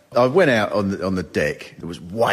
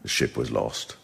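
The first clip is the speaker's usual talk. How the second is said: solemn museum guide; 9 semitones lower, 7 dB quieter than usual. Retold or imitated. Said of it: "And he said".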